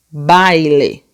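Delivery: word BAILE drawn out slowly; slow demonstrative reading. Shown as a demonstration. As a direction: stretch BAILE so each vowel is heard. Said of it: "Vamos ler todo mundo junto".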